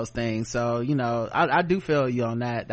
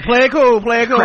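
mock pirate; 10 semitones higher, 8 dB louder than usual. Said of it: "He's like".

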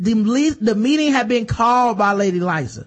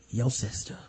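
mock low confession; 8 semitones lower, 12 dB quieter than usual. Littlefinger's cold confession.

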